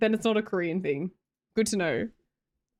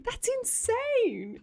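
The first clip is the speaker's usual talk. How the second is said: amazed; much slower than usual. Real, not acted. Amazed at smart niece.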